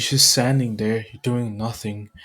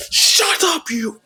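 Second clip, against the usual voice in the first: high, loud accusing yell; angry shout; 3 dB louder than usual. Acted. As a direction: order a villain to submit, furiously. Heroes attack supposed villain.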